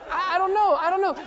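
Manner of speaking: high, loud, panicky stammer; hammed-up helpless shout; flustered yelling back. Student pilot to his yelling instructor, flustered shout.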